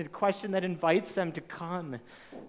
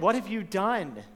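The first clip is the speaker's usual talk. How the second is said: higher, louder accusing question from a parent; 6 semitones higher, 6 dB louder than usual. Retold or imitated. Parent confronting kids who did wrong, accusing tone. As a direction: press the guilt home like a stern parent. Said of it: "in that tone".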